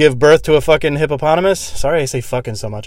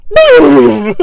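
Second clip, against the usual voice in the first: very loud high yell; surprise shout; 13 dB louder than usual. Retold or imitated. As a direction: burst out yelling. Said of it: "jump out of a hamper and go".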